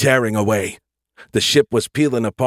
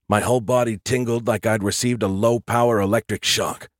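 usual speaking voice

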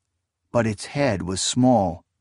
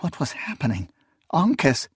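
ordinary delivery

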